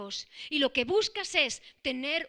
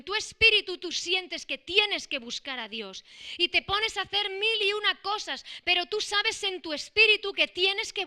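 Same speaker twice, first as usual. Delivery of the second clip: loud, driving sermon voice; preaching at full force; 3 semitones higher, 4 dB louder than usual. Acted. Preacher urging the church to seek God, in full-force preaching. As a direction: press the appeal hard on every phrase.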